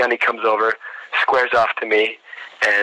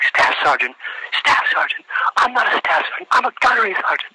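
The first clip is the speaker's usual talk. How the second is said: outraged, high-pitched military correction; drill-instructor scream; 12 semitones higher than usual. Retold or imitated. Gunnery sergeant correcting a candidate's mistaken rank, mock screaming. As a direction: yell it as an offended drill instructor. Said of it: "I just hear him screaming".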